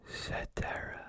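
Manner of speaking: soft unvoiced whisper into one ear. Shown as a demonstration. Doctor whispering a test word to patient, whispered. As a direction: breathe the word close to the ear. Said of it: "Okay, here we go".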